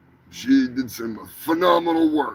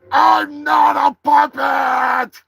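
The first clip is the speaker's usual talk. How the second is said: much higher and louder, slow and stretched; indignant shout; 11 semitones higher, 6 dB louder, slower than usual. Real, not acted. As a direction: bellow the denial, outraged. Indignant denial of being a puppet.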